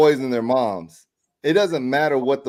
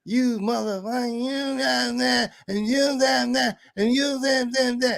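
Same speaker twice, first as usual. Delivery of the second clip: high, slow, hammed-up string of curses; mock cussing out; 9 semitones higher than usual. Lady keeps swearing at him in the lot, mock angry sputter. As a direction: imitate her sputtering rant. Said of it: "She just cussing me out".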